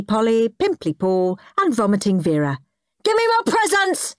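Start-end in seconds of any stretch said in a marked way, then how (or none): 3.0-4.1 high-pitched demanding shriek; greedy shriek. A narrator's reading. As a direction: shriek it like a grabby child. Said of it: "he shrieked".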